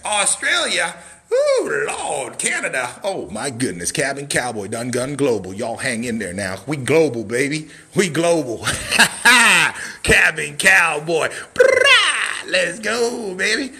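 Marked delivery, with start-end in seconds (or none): 1.3-1.8 high shouted woo; shouted whoop. Real, not acted. Seller whooping as countries roll in, shouted cheer. 6.7-11.3 loud, laughing brag; laughing hype. Real, not acted. Seller laughing loudly about going global. 11.5-12.5 high, loud yelled brah; hype yell. Real, not acted. Seller yelling hype at his live viewers. Hyped exclamation.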